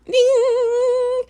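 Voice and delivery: a high, bright ding like a bell chime; imitated chime. Telling the host how his voice sounds: high imitated ding. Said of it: "his voice to me is like".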